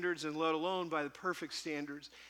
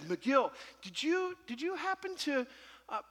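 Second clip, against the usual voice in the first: teasing buddy barely holding in his laughter; laughing question; 7 semitones higher than usual. Retold or imitated. Buddy Taffy teasing the preacher, laughing through the question. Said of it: "he's laughing and laughing".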